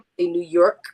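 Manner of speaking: demonstrating a New York accent on "New York"; New Yorker showing how she says it. Shown as a demonstration. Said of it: "read it with my accent".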